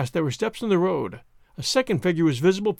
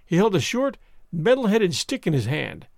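plain reading voice

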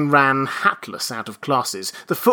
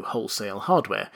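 plain reading voice

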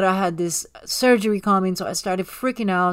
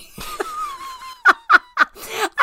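high-pitched helpless cackle; laughing. Real, not acted. Cracking up at her own cleansing story, high laughter. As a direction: burst out in a long, high laugh.

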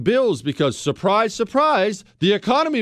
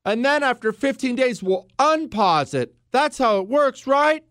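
mocking politicians; 8 semitones higher than usual. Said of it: "When they said".

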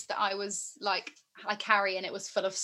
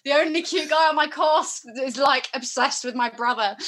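mock dismay; 3 semitones higher, 10 dB louder than usual. Her retold dismay at the guy.

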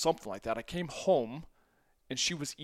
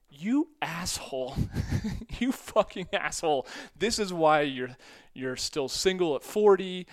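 mock angry rant; 4 semitones higher, 3 dB louder than usual. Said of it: "she just starts to go on a, on a rant".

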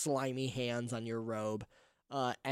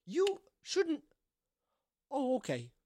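taken-aback lady mayor; 12 semitones higher, slower than usual. Acted.

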